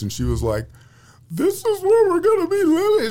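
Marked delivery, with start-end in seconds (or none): 1.3-3.1 high wailing mock sob, much higher and louder; squeaky tearful wail of disbelief; imitating wife crying. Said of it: "crying and stuff".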